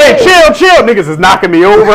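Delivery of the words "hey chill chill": loud, high "Hey, chill, chill"; telling people to chill. Retold or imitated. Knocked around, loudly telling the guys to chill. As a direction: call out sharply over a shoving crowd. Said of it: "now I'm like".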